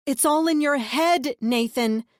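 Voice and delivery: loud, slow pleading reassurance; urgent reassurance. A narrator's reading. Narrator urging the brother it isn't real. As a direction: talk him down, firm and slow. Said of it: "I said".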